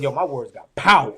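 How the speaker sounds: loud, awed exclamation; telling the host his breakthrough moment, loudly amazed; excited realisation. Retold or imitated. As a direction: proclaim it like a revelation. Realising his impact, retold.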